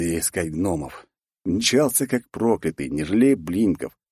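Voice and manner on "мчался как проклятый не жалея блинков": plain reading voice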